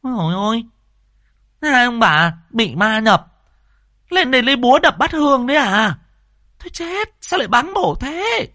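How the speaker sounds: high, scandalised busybody; aghast, scolding cries in a high voice; startled. A narrator's reading. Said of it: "thì mụ giật mình".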